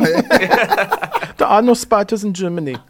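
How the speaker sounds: slow, high, loud fake German accent; joking German trainer in a put-on German accent; mock German accent. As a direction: drawl it out in a hammy German accent. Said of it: "in a heavy German accent".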